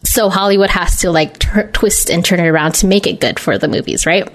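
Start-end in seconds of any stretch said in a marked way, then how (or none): none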